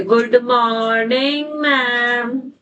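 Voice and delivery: very slow singsong pupil chant; imitating schoolchildren's greeting. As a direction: drag out every word like a class greeting its teacher. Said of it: "बच्चे वो ऐसे ही बोलते थे खड़े".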